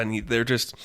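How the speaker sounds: plain reading voice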